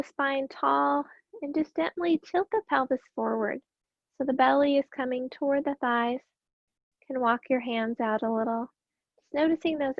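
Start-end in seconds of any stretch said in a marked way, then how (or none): none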